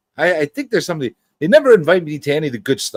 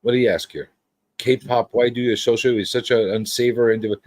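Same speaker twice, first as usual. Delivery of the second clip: ordinary delivery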